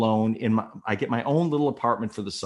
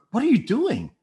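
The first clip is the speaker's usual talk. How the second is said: high, alarmed outburst; startled protest; 14 semitones higher, 4 dB louder than usual. Retold or imitated. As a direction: blurt it out in high-pitched shock. Startled by the sudden kiss.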